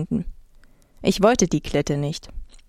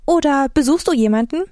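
high, perky question; pry brightly, pitched up; too enthusiastic; 7 semitones higher than usual. A narrator's reading. Girl prying into a stranger's trip, high and eager. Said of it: "Selbst ihr Ton klang viel zu begeistert".

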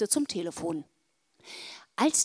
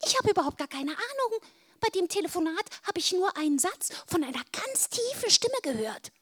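squeaky voice; 8 semitones higher than usual. A narrator's reading. Squeaky caller continues.

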